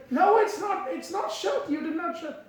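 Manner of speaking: high, sharp scolding shout; angry shouting. Retold or imitated. Devotee refusing to listen, shouting high and angry. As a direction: cut her off, yelling her down. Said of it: "He was really, like, shouting".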